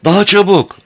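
loud, high, urgent yell; full-force shout. A narrator's reading. Amber hurrying the swimming Penrod, shouting. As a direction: shout with all your strength. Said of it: "Amber var gücüyle bağırıyordu".

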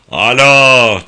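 loud shouted phone greeting; shouting. A narrator's reading. Wacker Ball answering in the club's phone booth, shouting. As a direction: call out loudly into the receiver. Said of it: "diye bağırdı Wacker Ball".

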